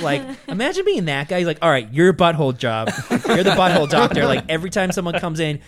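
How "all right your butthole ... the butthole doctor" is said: retold boss assigning jobs. Acts a boss assigning the job.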